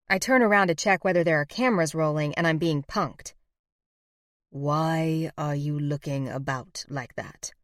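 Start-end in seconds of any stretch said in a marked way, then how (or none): 4.5-7.5 slow, lazy and a little lower; lazy drawl. A narrator's reading. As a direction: stretch it out, unbothered. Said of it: "Gabriel drawls".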